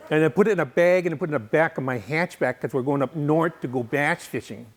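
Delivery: hammed-up Wisconsin local talk; thick Midwestern accent put on for laughs. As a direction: play a folksy Wisconsinite spinning a beer-and-fishing errand. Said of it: "those accents, uh, I don't know, it's just like".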